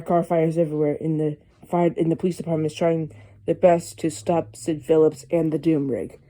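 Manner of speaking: ordinary talk, nothing marked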